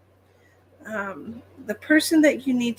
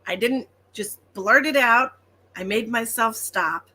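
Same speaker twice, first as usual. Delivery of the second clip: normal talking voice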